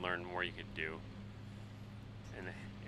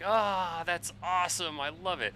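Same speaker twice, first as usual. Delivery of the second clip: mock delight; 9 semitones higher, slower than usual. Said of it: "and it's like".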